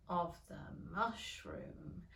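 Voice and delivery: very slow, low and soft; lightly voiced sleepy caterpillar. A narrator's reading. Caterpillar answering from afar, slow and low. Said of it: "said the caterpillar".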